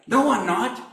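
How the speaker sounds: high, loud denial; defensive denial. Acted. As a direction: snap back a denial. Peter denies, acted dialogue.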